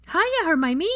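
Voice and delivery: put-on high, loud excited child voice; little boy Teddy. Teddy greeting Hermione at the door, excited child voice. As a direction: squeal it like an eager little boy.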